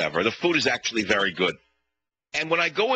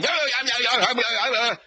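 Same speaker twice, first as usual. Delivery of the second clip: much higher, rattled off yeahs like a rushed clerk; busy yelling restaurant clerk; 10 semitones higher than usual. Retold or imitated. Caller mimics the busy Japanese restaurant clerk, rushed yelling. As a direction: mimic a harried order-taker brushing him off. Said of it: "They're always yelling".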